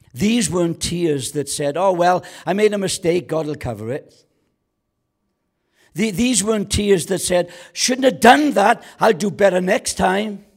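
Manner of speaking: high, loud mock-casual regret; mock the cheap tears; preaching at full force. Preacher mocking shallow tears, high and loud.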